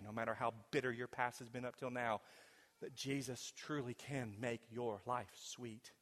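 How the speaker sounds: ordinary talk, nothing marked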